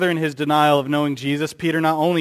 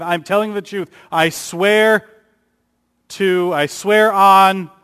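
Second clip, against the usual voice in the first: pleading oath; 5 semitones higher, 6 dB louder, slower than usual. Acted. Voicing Peter's desperate oath.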